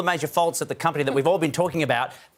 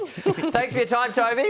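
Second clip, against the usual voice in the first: laughing; 7 semitones higher than usual. Real, not acted. Host laughing while wrapping up.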